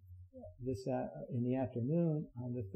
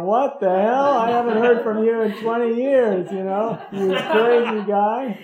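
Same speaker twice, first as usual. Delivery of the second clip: much louder and higher, laughing, surprised shout; astonished old friend; 8 semitones higher, 16 dB louder than usual. Pretend. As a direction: play the old buddy hollering in happy disbelief. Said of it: "he's gonna go".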